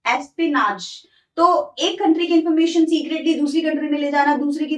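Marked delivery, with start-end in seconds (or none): 0.1-1.0 slow, separated "एस्पिनाज", falling at the end; pronunciation demo. Shown as a demonstration. Teacher shows students how to pronounce "एस्पिनाज", slow and careful. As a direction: say "एस्पिनाज" slowly, syllable by syllable. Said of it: "इसको आप कैसे pronounce करोगे".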